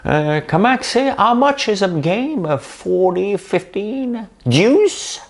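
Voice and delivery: very high, giddy acted interviewee voice; big fake smile. Dodging accounting questions with tennis talk, big smiling falsetto. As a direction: squeal it through an ingratiating grin. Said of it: "avec un grand sourire".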